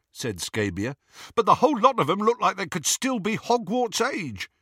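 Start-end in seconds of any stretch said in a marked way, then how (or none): 1.3-4.5 high, fast henchman voice; cocky henchman. A narrator's reading. Gang member sizing up captives, high quick cockiness. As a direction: rattle it off up high. Scabior's voice.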